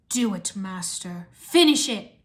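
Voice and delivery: villain egging on his master, rising in force; urging. A narrator's reading. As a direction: start low and slow, then push hard. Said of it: "Vex urged".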